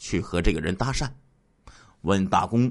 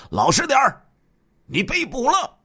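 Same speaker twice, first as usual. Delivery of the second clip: stern shout. A narrator's reading. Fake policeman startling a suspect in bed, stern shout. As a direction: bark it out like a police order. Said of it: "厉声喝道".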